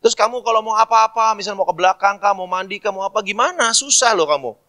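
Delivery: high, loud nagging; exasperated older sibling. Pretend. Older sibling urging the younger home, exasperated. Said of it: "Kalau saya umpama kakaknya ya".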